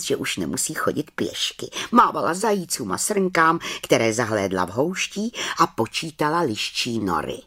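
plain reading voice

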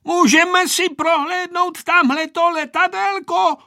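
put-on very high, loud boy's question; child calling out. A narrator's reading. Vojta asking grandpa to see the plane, calling loudly. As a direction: pipe it up loudly into an old man's ear. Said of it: "odvážil se zavolat Vojta do dědečkova ucha".

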